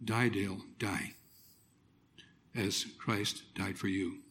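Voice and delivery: inner thought. Retold or imitated. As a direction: murmur it as a private thought. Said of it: "And I'm thinking".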